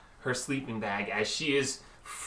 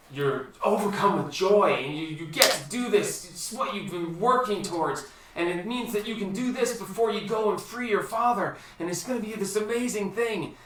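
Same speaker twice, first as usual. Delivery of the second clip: higher, lively, joyful narration; surging joy; 8 semitones higher than usual. A narrator's reading. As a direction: carry them up into the joy.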